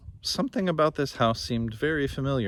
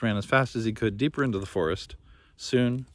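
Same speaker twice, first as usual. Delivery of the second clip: usual speaking voice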